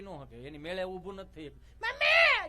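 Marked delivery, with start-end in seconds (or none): 1.6-2.5 much higher, loud wailing cry; child wail. Retold or imitated. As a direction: shriek for mummy after a fall. Child falls and calls mom. (પડેલા છોકરાની mummy માટે ચીસ)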